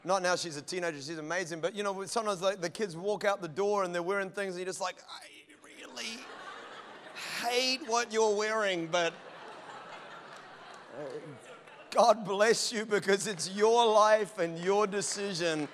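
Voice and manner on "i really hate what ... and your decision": slow, forced calm acceptance; strained tolerance. Retold or imitated. Parent to teen in odd clothes, slow strained tolerance. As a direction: bite your tongue and bless them slowly.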